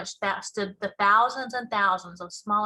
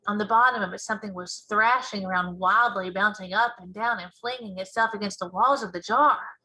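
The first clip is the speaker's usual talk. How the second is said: ordinary delivery, nothing marked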